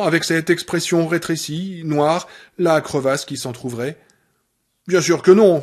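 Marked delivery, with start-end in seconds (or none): 4.9-5.6 loud bitter sarcasm; bitterly sarcastic. Acted. Annie mocking Paul's denial, bitterly sarcastic. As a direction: sneer it out. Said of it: "d'un ton amèrement sarcastique".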